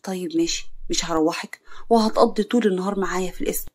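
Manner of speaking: ordinary delivery, nothing marked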